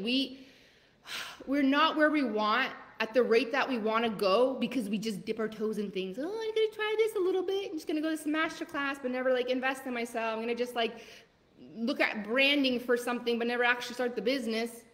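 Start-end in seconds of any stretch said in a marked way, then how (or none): 6.2-14.8 mock hesitant dabbler. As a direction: mimic the person who only dips a toe in. Mocking someone who dips toes.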